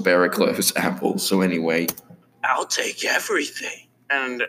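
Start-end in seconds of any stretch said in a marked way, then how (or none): 2.4-4.4 high, thin character voice; Enzo. Acted. Greedy customer ordering at the drive-thru, in character voice. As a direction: put on a higher cartoon customer voice. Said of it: "That sounds like Enzo".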